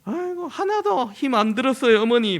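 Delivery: high, bright put-on politeness to mother-in-law; forced cheerful reassurance, pitched high; daughter-in-law's fake cheer. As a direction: chirp it sweetly like a dutiful daughter-in-law. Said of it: "며느리들은 이렇게 좀 표현을 하는데".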